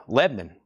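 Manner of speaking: "Lebanon" squashed to LEB-n'n; "Lebanon" with middle vowel dropped, LEB-nun; local clipped pronunciation. Shown as a demonstration. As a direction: say "Lebanon" as nearly one syllable. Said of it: "That's one syllable".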